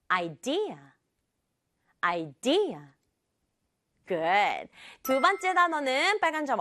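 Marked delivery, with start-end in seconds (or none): 0.1-0.8 "idea" stressed on its second syllable, the D. Shown as a demonstration. Teacher modelling a word for children to repeat, stress on the D of "idea". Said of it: "D 부분이 강조예요". 2.0-2.8 "idea" with the D syllable punched; stress on the D. Shown as a demonstration. Teacher repeating for the class, stress on the D of "idea". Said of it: "D 부분이 강조예요".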